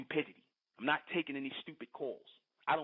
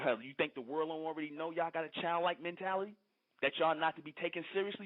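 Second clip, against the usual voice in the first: normal talking voice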